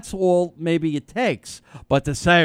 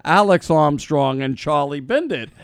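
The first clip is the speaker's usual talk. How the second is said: mock lawyer quoting back; 3 semitones higher, 5 dB louder than usual. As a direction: play a lawyer reading your own words back at you.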